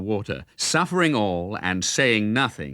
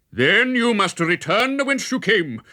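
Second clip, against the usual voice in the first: raised, higher mock roar; roaring friar; 7 semitones higher than usual. A narrator's reading. Friar ordering Robin back across the river, a raised roar. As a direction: bellow it down at him. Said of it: "roared the friar".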